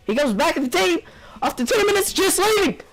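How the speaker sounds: high-pitched, climbing exasperated rant; incredulous outburst. Real, not acted. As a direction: let disbelief drive the voice way up.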